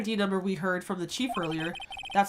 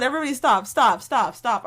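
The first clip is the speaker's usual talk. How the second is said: loud scolding; 9 dB louder than usual. Real, not acted. Loud commands to chat.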